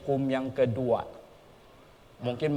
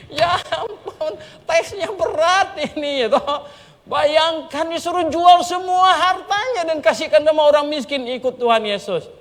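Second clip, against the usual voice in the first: full-force preaching; 13 semitones higher, 7 dB louder than usual. Acted. (seruan khotbah, tinggi dan keras)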